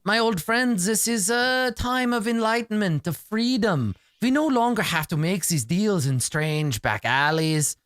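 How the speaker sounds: lofty, slow doctor voice; grand doctor voice. Acted. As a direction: preach enlightenment like a pompous host.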